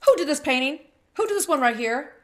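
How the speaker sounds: loud demanding question; professor calling out. Retold or imitated. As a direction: call out to the class, who did this. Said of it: "He goes".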